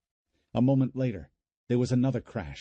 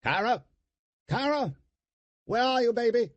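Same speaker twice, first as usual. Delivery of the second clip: calling out; 13 semitones higher than usual. Acted. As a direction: shout for her through the house. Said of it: "uncle calling out".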